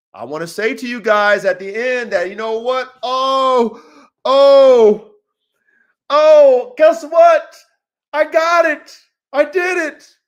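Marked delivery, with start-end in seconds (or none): 3.0-10.2 loud, high victory shouts with held oh's; triumphant outcry. Pretend. Coach telling his men he finally made it, triumphant outcry. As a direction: cry out the imagined win in bursts. Imagines announcing his future win.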